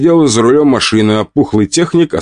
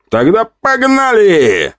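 battle cry; 12 semitones higher, slower than usual. Acted. Charging into battle.

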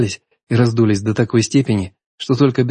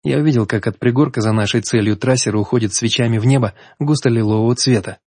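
plain reading voice

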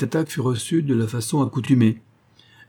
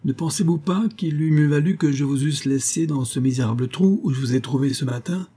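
curt, higher-pitched snap back; brusque retort; 5 semitones higher than usual. A narrator's reading. Host snapping at his nervous guest on the stairs, curt. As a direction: cut him off sharply. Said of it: "répliqua brusquement Parsons".

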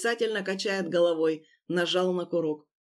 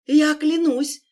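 high, louder desperate plea; pleading insistence; 8 semitones higher, 8 dB louder, slower than usual. Acted. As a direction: swear it desperately. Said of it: "Настаивал он".

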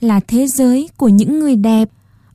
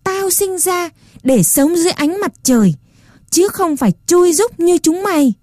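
much higher defiant cry; shouting louder; 8 semitones higher than usual. A narrator's reading. Puppet boy shouts back at the rat king, defiant. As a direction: proclaim it fiercely at the rats. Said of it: "lại thét to hơn".

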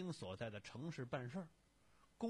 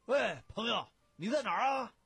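loud, high-pitched holler; boisterous phone greeting; 10 semitones higher, 11 dB louder than usual. A narrator's reading. Friend phoning his visiting pal at the hotel, boisterous. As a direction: boom it into the phone, high and hearty.

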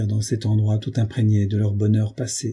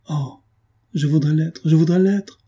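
higher-pitched despairing cry, building louder on the repeat; anguished outcry; 6 semitones higher, 3 dB louder than usual. A narrator's reading. Woman wishing herself dead to her beloved, anguished outcry. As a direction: cry it out on the edge of sobs, rising. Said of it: "s'écria-t-elle, dans un brusque accès de sanglots".

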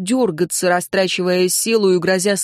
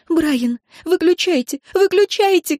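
high, sobbing yell; frantic crying out; 10 semitones higher than usual. A narrator's reading. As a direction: cry out desperately, climbing higher each time. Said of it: "кричала Энн". (отчаянный крик сквозь рыдания)

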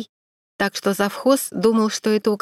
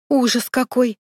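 higher, shocked exclamation; exclaim; 4 semitones higher than usual. A narrator's reading. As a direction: gasp out the horror. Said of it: "воскликнула ... гримёрша Лиля". (восклицая с ужасом)

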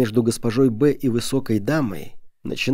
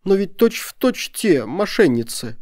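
high, drawn-out protest; self-justifying; 8 semitones higher, slower than usual. A narrator's reading. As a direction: plead your excuse in a high, slow voice. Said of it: "попробовала было оправдаться госпожа Б".

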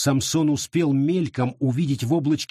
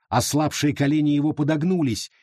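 plain reading voice

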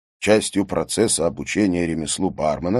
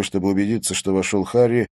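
ordinary delivery

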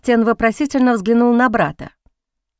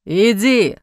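menacing growl. A narrator's reading. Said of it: "грозно прорычал Бастиан".

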